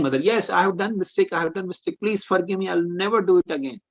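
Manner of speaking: put-on high, begging voice of a scared little boy; pleading child. Child Krishna begs Mother Yashoda to forgive him, high pleading. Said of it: "he started pleading".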